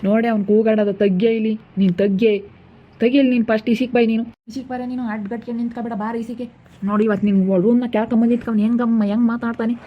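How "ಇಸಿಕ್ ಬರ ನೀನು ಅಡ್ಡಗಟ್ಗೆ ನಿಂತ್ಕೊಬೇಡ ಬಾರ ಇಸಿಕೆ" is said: much softer, telling her to move aside; softly pushing his wife out of the way. Real, not acted. Husband tells wife to step aside.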